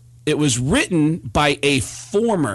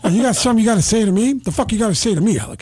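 quick, raised, menacing challenge; hostile Pacino; 6 semitones higher, faster than usual. Retold or imitated. Pacino confronting Baldwin in the hallway. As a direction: square up to him. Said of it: "And he says".

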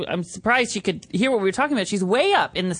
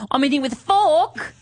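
high, put-on Aussie accent; Australian accent; 6 semitones higher, 3 dB louder than usual. Host jokes about eating salad, mock Australian accent. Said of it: "that's my Australian accent".

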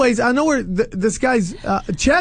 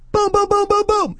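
rapid high-pitched booms; string of high, punchy booms; punch sound effects; 12 semitones higher than usual. Retold or imitated. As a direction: imitate a flurry of landing punches, pitched way up. Acts out a punch combination.

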